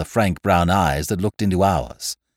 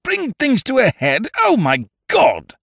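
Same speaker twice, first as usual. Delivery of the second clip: very high, incredulous echo, then a louder cry; appalled cry; 5 dB louder than usual. A narrator's reading. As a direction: cry out in horrified disbelief. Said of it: "cried Lord Yardley".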